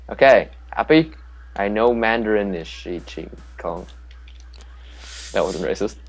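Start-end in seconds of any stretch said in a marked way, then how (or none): none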